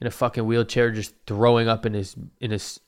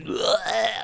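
high, short mock heave; imitated retching; 11 semitones higher than usual. Wheelchair man throwing up, imitated retch. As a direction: mimic a puking groan.

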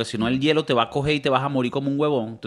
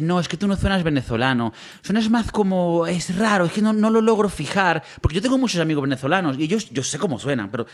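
imitated Castilian Spanish accent with lisped z; Spaniard. Spanish coworker doubting he's Venezuelan, mimicked Castilian accent. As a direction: talk like a Spaniard from Spain. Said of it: "es español".

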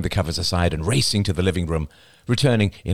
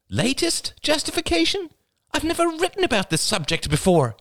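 Rachel incredulous. A narrator's reading. Rachel outraged by misquote.

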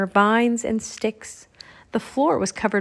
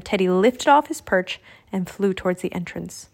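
plain reading voice

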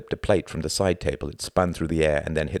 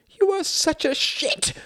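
slow, very high anguished cry; cried out in agony; slower than usual. A narrator's reading. Wife cries out at husband's mockery, agonised. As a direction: wail the insult in torment. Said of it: "she cried out".

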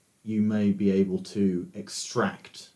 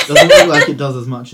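laughing; 5 semitones higher, 15 dB louder than usual. Real, not acted. GM describing a failed hit.

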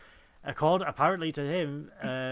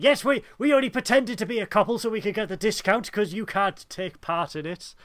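excited explanation; 6 semitones higher, 6 dB louder than usual. Retold or imitated. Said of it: "She's like".

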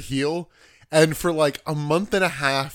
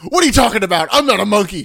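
loud, higher mock-indignant shout; acted-out angry wrestler, raised voice; imitated angry outburst; 5 semitones higher, 8 dB louder, faster than usual. Host imitates the wrestler angry backstage, loud indignant yelling. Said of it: "Like, shoot angry backstage".

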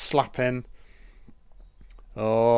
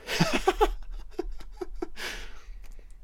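soft, high breathless laugh; long wheezy chuckle fading out; wheezing laughter; 8 dB quieter than usual. Real, not acted. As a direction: laugh quietly until out of air. Laughing at own dark joke.

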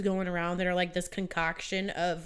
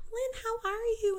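concerned mom; 4 dB quieter than usual. Retold or imitated. Mom phoning her sick daughter, high mom voice. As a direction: imitate a mother checking in, pitched way up. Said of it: "mom calls me".

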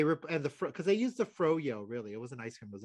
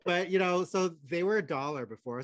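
usual speaking voice

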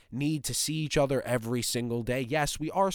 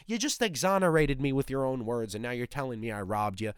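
ordinary talk

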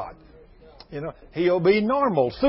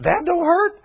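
high, loud disbelieving echo; incredulous; 11 semitones higher, 5 dB louder than usual. Shown as a demonstration. Preacher echoing the burned woman, high and incredulous. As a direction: throw it up high in mock disbelief. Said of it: "preacher mocks disbelief".

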